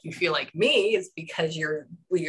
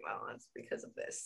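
whispered, low and knowing; 9 semitones lower, 17 dB quieter than usual. Retold or imitated. Psychic retelling her answer to the client, whispered. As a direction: whisper it like a secret you already knew. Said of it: "And I was like".

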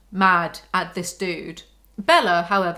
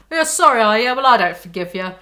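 mock refusal; 7 dB louder than usual. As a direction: throw the apology back, playfully forceful.

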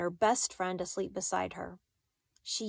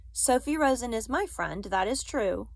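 plain reading voice

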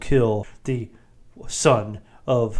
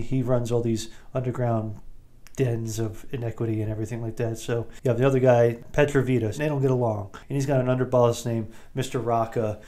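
usual speaking voice

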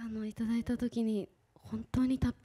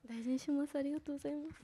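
laughing through tears; 5 semitones higher, 6 dB quieter, much faster than usual. Real, not acted. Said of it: "えー、泣いてるじゃないですか。".

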